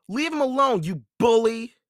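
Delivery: high, loud and defiant; indignant cry. A narrator's reading. Annie confronts the pirate holding Jack, indignant. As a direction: cry out in defence of someone. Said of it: "she cried".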